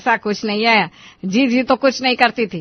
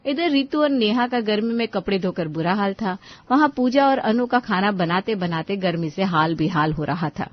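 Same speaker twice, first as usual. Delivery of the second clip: lower, softer narrator voice; story narration; 3 semitones lower, 3 dB quieter than usual. A narrator's reading. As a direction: narrate calmly, letting it fade. Narrator voice between scenes.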